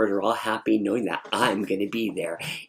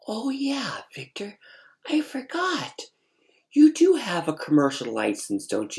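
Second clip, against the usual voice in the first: lightly voiced director, pitched up, part whispered; high voice for the choir director; 10 semitones higher than usual. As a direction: play the director as an airy, high-voiced figure.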